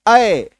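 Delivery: अय as an open a gliding towards y; double-matra vowel. Shown as a demonstration. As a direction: stress अय, opening it into a glide. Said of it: "double एकार की मात्रा को जन्म देते हैं".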